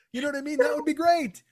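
ordinary talk, nothing marked

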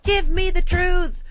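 slow dramatic movie shout; mock Tom Cruise demand. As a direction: drag out the line like a movie courtroom climax. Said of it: "like Tom Cruise in that movie".

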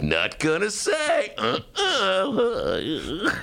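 high, slow coy refusal; playful imitation of evasive Steyer; mock Tom Steyer. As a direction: tease out a sing-song no. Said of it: "And Steyer's like".

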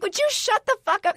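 high-pitched, exasperated comic retort; angry retort. Retold or imitated. Snapping back at a nosy Lyft driver, acted exasperation. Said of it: "I get so angry".

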